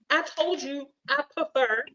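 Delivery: normal talking voice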